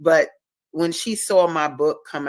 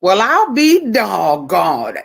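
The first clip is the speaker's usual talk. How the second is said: slow, loud and higher, in awe; amazed; 5 semitones higher, 10 dB louder, slower than usual. Real, not acted. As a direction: marvel at it out loud. Amazed at seeing things again.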